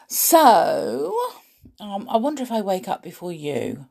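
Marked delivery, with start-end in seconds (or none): none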